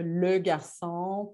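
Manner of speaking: le garçon said slowly and deliberately; slow demo word. Shown as a demonstration. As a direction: articulate "le garçon" slowly, syllable by syllable. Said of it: "je leur disais le". (« le garçon » prononcé lentement)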